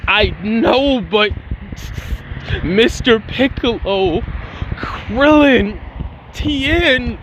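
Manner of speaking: acted tearful lament, halting and drawn out; crying. Gohan grieving fallen friends, crying in broken, drawn-out phrases. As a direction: choke out each name slowly through mock sobs. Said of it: "falls down crying".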